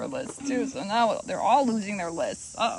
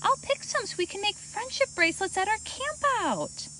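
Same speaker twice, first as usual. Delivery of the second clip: character voice; 11 semitones higher than usual. A narrator's reading. Camille's thought, high voice.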